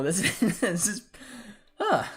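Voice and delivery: words broken up by laughter; too amused to finish the phrase; laughing. Real, not acted. Streamer overcome by Mounier's turnaround, laughing. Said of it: "probably the most I've laughed".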